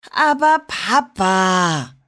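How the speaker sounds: langsam, gedehnt protestierend; leiser Protest. A narrator's reading. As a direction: zieht das Aber Papa quengelnd in die Länge. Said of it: "protestierte Liliana". (Kind protestiert beim Vater, langsam und gedehnt)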